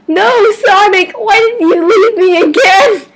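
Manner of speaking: high, loud hammed-up wail; mock ragged sobbing. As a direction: wail at the dead hero, overdoing it. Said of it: "He sobbed raggedly".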